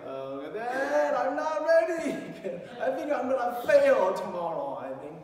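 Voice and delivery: high, loud, panicked pleading, with a nervous laugh; panicked son. Retold or imitated. Son calling dad at night, panicked and pleading loudly. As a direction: play the son panicking the night before his midterm. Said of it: "he was in panic".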